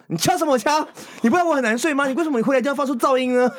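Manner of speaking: imitating an angry scolding. Boyfriend complaining about the knocking noise, in a high, imitated angry rant. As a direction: rant at the knocking like an irritable, half-asleep boyfriend. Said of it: "他说".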